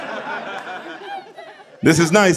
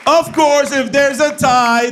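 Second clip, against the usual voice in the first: hype announcer; 7 semitones higher, slower than usual. Real, not acted. Host announcing the cash prize to the crowd, hype shout. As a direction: sell the five hundred dollars.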